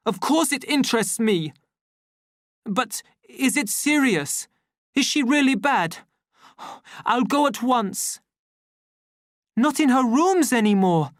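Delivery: alarmed by bad news; savage on phone. A narrator's reading. The savage taking an urgent call, alarmed.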